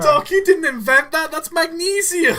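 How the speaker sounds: put-on high, loud protesting teenager; Marty. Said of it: "Marty's gotta have".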